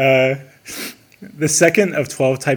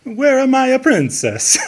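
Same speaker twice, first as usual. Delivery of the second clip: high cartoon Mario voice, Italian accent; Mario crying out; 13 semitones higher, 4 dB louder than usual. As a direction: cry it out as Mario searching for Peach. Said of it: "cries this out when he can't find Peach".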